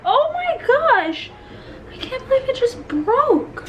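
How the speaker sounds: high, loud, drawn-out dismay; loud high-pitched lament over a breakage; upset wail. Real, not acted. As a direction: wail in distressed disbelief. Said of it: "I am so sad".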